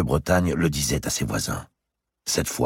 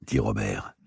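usual speaking voice

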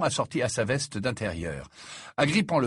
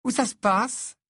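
put-on dreamy girl voice; 13 semitones higher, 4 dB louder, slower than usual. A narrator's reading. Luna voiced, high.

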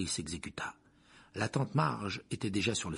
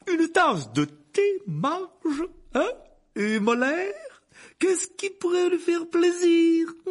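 jovial uncle; 8 dB louder, slower than usual. Acted. Jovial Vernon fussing over guest.

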